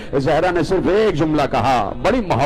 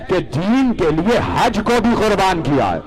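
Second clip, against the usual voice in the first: higher and louder, pressing on بھی; raised emphasis; 6 semitones higher, 3 dB louder than usual. Acted. Preacher hammers home the sacrifice, raised voice. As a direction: lift حج کو بھی up hard like a preached point.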